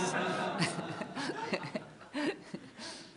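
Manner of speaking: a real laugh at his own joke; a real, easy laugh. Real, not acted. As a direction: laugh along after the punchline.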